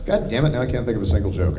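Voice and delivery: ordinary talk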